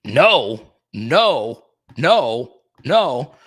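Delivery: loud, separate, repeated refusals; real outburst at the game; loud protest. Real, not acted. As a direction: call out each one loudly, with a gap between. Reacting live to the game.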